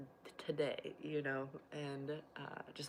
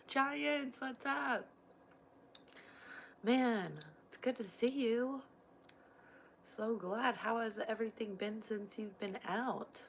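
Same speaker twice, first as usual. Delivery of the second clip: higher, bright welcome back; excited greeting; 6 semitones higher than usual. Real, not acted. Welcoming a returning viewer back, excited.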